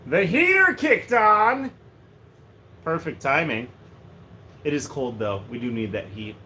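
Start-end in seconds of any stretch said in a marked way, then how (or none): 0.1-1.7 loud, high, drawn-out exclamation; excited announcement. Real, not acted. Streamer breaks off mid-chat as the heater kicks on, excited announcement. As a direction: call it out big and slow.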